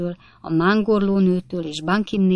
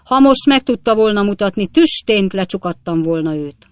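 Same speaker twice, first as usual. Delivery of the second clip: indignant judge; 3 semitones higher, 7 dB louder than usual. A narrator's reading. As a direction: scold sternly from the bench. Judge threatens to jail heckler.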